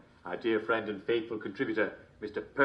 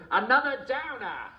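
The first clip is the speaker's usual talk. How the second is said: gleeful punchline; 12 semitones higher, 4 dB louder than usual. Acted. Punchline delivered.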